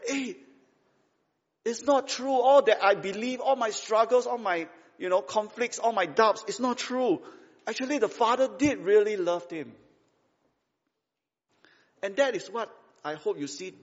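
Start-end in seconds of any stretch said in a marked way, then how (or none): 0.1-9.7 high-pitched, urgent astonishment; sudden realization. Acted. Son realizing his father loved him, astonished. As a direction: let the truth dawn excitedly. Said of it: "finally realized that".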